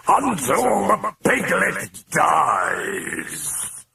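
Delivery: high, slow, raging roar of a demon; drawn-out furious demonic shout. A narrator's reading. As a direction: bellow it out like a possessed beast. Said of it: "contorted in rage as it roared".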